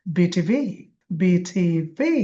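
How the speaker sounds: BTV with the final ভি stressed and rising; slow, separated letters, weight on ভি in BTV; stress on last letter. Shown as a demonstration. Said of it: "বিতে জোর হবে".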